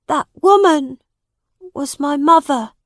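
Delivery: high-pitched, sorrowful confession; sad. A narrator's reading. New king owns up about his mother, sadly. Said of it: "said Tom sadly".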